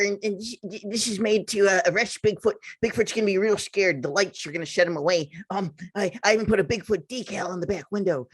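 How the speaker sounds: stammering nerd caricature; really nerdy guy. Retold or imitated. Imitating a Bigfoot convention guy showing his cop car, nerdy voice. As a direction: play an awkward, nerdy Bigfoot hunter tripping over his words. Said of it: "just really nerdy".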